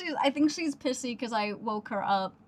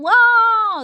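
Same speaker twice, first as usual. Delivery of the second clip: loud, high sarcastic wow; mock hype; 8 semitones higher, 12 dB louder than usual. Real, not acted. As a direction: cheer your own news like a fake crowd. Mock hype of own news.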